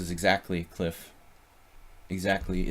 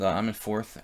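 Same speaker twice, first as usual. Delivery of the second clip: ordinary delivery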